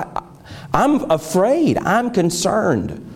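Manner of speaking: high, pleading retold prayer, not full sobbing; crying out to God. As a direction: plead with God, voice climbing, slowing on I'm afraid. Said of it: "I cried out to God. I said".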